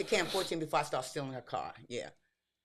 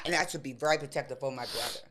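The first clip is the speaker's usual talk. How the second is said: usual speaking voice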